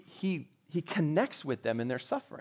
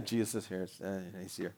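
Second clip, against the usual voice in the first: low, soft, hammed-up gloom; mock sad; 7 semitones lower, 10 dB quieter than usual. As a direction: pretend to be sad, trailing away softly. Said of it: "You know, act all sad".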